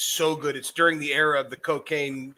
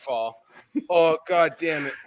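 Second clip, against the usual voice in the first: usual speaking voice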